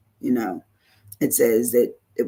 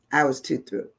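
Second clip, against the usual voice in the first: ordinary talk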